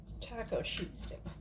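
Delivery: soft whispered name of the dip. Shown as a demonstration. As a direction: breathe it out in a whisper. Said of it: "That's what it'll be".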